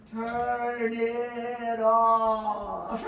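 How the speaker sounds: very slow drawn-out call, as if heard from far off; muffled and slowed. Retold or imitated. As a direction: stretch it like a dazed memory. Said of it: "here's what I heard".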